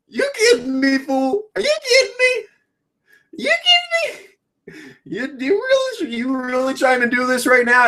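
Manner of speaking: very high, squeaky disbelief; incredulous. Retold or imitated. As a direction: shriek it like you can't believe it. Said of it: "I'm just sitting there like".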